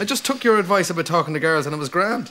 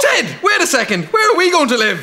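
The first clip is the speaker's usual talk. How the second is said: sudden alarm; 12 semitones higher, 4 dB louder than usual. Acted. Realises they lost the house.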